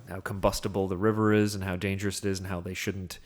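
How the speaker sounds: ordinary talk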